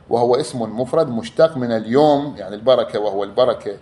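ordinary delivery

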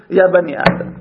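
usual speaking voice

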